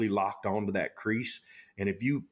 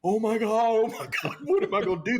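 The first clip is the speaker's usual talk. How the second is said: fast, high, louder mock panic; panicked; 12 semitones higher, 4 dB louder, faster than usual. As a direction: blurt it out like you're terrified. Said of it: "And I'm like".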